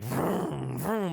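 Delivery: mouthed car revs; imitated engine revving. Storyteller imitating the killer cab in an alley, engine revs. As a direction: rev it like an engine, rising on the second. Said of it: "then you hear, like".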